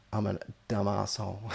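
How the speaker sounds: usual speaking voice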